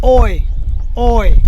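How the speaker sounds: ओय said as one gliding "oy"; "oy" sound. Shown as a demonstration. Teacher shows learners the German vowel pair, ओय as "oy". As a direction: demonstrate ओय as a clear "oy" diphthong. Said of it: "इनका sound निकलता है".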